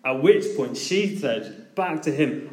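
ordinary talk

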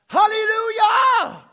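high, loud, drawn-out hallelujah; joyful shout. Real, not acted. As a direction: cry it out at the peak of joy. Preacher's climactic cry.

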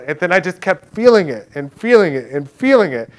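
ordinary talk

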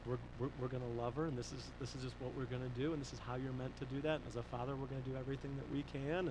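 low, soft, quick promise; quietly commit to it; subdued resolve. Retold or imitated. Pastor telling church he will love his daughter, low and earnest.

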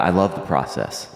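ordinary talk

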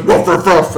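barking like a dog; imitating a dog barking; mock dog bark. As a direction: woof it out like a playful dog. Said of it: "and he goes".

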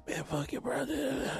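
angry nephew. Retold or imitated. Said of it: "my nephew called me all angry".

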